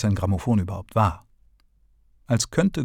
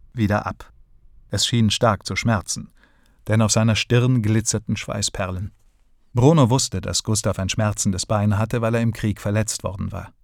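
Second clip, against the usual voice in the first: usual speaking voice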